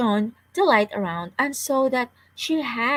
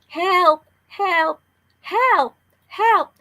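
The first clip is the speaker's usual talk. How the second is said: high, loud calls for help over and over; trapped girl calling again and again; repeated shouts; 9 semitones higher, 4 dB louder, much slower than usual. A narrator's reading. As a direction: keep yelling so they can find you. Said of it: "kept screaming".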